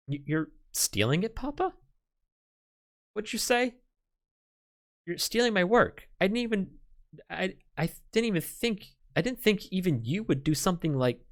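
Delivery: a daughter who cannot believe her father is stealing her work; shocked disbelief. Acted. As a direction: stammer it out, stunned and hurt.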